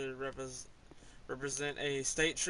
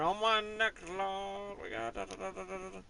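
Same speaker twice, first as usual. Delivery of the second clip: auctioneer voice; 6 semitones higher than usual. Pretend. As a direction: play a quick auction caller. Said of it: "sound like an auctioneer".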